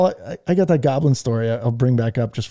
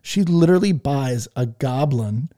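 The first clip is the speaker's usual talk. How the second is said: normal talking voice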